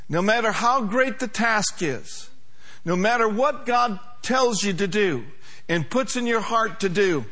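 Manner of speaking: usual speaking voice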